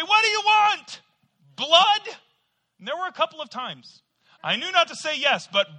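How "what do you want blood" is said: high, loud scolding mother; exasperated mom. Retold or imitated. Mom scolding her complaining son, high and loud. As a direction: snap at the whining son with a loud, high mock rebuke. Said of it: "My mom would say".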